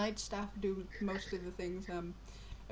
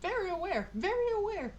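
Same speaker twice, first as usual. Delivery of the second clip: drawling elf; 10 semitones higher, 9 dB louder than usual. Acted. Tired elf dismisses innkeeper.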